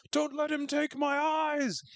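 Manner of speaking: high, slow mock terrified cry; frightened old lady. Host voicing the grandma freaking out, high mock fear. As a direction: plead in a quavering panic. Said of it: "The old lady's freaking out".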